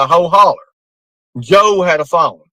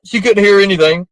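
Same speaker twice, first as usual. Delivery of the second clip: ordinary talk, nothing marked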